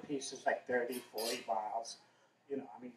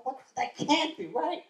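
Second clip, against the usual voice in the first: disbelief; 9 semitones higher than usual. Retold or imitated. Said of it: "and I'm thinking".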